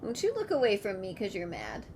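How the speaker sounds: ordinary talk, nothing marked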